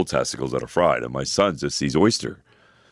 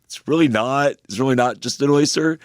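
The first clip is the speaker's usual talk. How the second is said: laughing denial; 11 semitones higher, slower than usual. Retold or imitated. Dad laughing at son's question.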